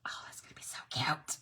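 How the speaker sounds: whispered aside; whispering to herself over the craft. Real, not acted. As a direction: breathe it under your breath.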